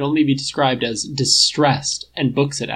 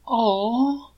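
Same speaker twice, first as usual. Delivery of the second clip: won over by something cute; charmed aww; 11 semitones higher than usual. Acted. Robot boops, Clint melts.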